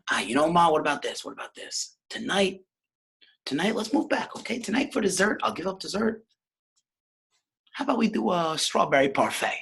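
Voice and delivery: high-pitched wheedling son; Scone. Acted. Son haggles with ma over dessert, high wheedling voice. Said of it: "Scone says".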